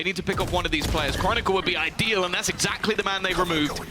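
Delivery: rising, excited play-by-play call; esports caster. Acted. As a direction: build the hype as the round swings. Live play-by-play casting.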